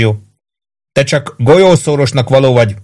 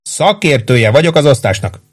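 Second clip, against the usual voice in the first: ordinary delivery, nothing marked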